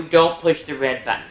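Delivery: ordinary delivery, nothing marked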